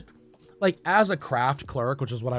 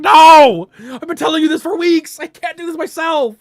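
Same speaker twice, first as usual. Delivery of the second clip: much higher, much louder fed-up shout; exasperated yell; 13 semitones higher, 10 dB louder than usual. Retold or imitated. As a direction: finally snap after weeks of repeating. Retold outburst at the boss.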